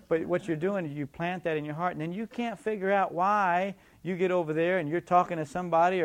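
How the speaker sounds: ordinary delivery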